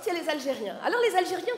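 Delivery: ordinary talk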